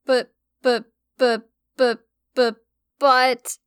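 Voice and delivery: halting repeated buts; stuttering. Acted. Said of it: "she stuttered out".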